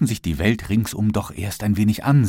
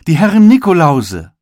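admiring greeting; 8 semitones higher, 9 dB louder than usual. A narrator's reading. Onlookers admiring in shop.

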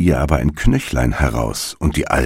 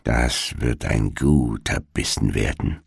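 low, softened greedy murmur; muttering to oneself; 5 semitones lower, 6 dB quieter than usual. A narrator's reading. Witch eyeing the sleeping children, murmuring to herself. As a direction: drop the pitch and mutter it privately. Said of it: "murmelte sie vor sich hin".

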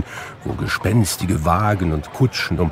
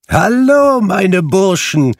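much higher and louder, hearty slow greeting; Fezziwig's jolly call; 12 semitones higher, 6 dB louder, slower than usual. A narrator's reading. Fezziwig calling the boys for Christmas, a loud hearty shout. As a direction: hail the lads like a merry old master. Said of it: "rief Fezziwig".

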